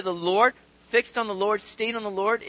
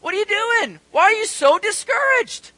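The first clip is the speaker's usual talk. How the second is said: loud, high-pitched self-reproach; exasperated pep talk to oneself; scolding himself; 9 dB louder than usual. Acted. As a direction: take yourself to task, loud and high. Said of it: "He's having a conversation with himself".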